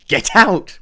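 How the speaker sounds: sudden loud, high order in character; acted shout. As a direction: burst out loudly to send them away. Lord dismisses the visitors again.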